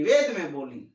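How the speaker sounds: ordinary delivery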